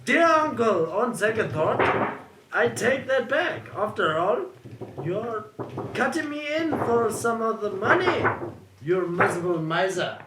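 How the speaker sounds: David cursing the mud and house, furious; angry cursing. Acted. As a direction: spit out each damn. Retake of David's curse.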